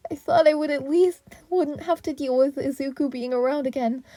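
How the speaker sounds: high whining near tears; boy ghost whining to Nana; mortified whine. A narrator's reading. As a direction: whine it in despair. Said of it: "Yuta let out a mortified whine".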